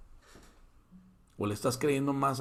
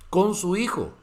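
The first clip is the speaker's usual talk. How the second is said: usual speaking voice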